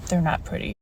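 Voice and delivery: soft, sighing they're not pretty; rueful echo. Retold or imitated. Looking at her own nails, soft rueful sigh. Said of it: "every time I look at these nails, I think".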